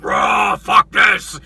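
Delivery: imitated roaring shout of fury; mock battle bellow; enraged roar. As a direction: roar it like a fed-up beast. Chewie's enraged roar acted out.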